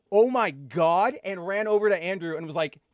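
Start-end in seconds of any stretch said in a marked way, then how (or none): 0.1-1.2 slow, louder stunned exclamation; stretched-out retold disbelief; re-enacted amazement. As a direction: replay your jaw-dropped reaction, drawn out. Retells stunned reaction to reading.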